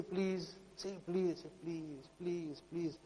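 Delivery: very soft, playful coaxing; gentle coaxing. Acted. Adult holding a lollipop over a child, soft teasing coax. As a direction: coax softly, word after word.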